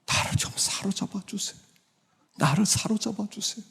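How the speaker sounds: high, soft whispered plea; breathy, tender supplication; hushed prayer. Shown as a demonstration. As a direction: beg God under your breath. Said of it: "이게 기도예요".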